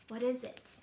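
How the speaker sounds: ordinary delivery, nothing marked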